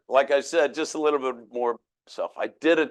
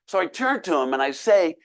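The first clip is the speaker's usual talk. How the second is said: ordinary talk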